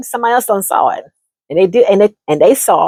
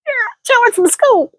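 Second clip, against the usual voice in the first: higher voice, slow, drawn out; sweetly confirming it's George from school; playing the other woman; 14 semitones higher, slower than usual. Explaining to her friend, higher and slow.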